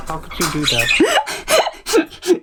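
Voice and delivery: slow, loud, appalled; mock scandalized ghost. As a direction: drag out the outrage. Voicing the embarrassed ghost.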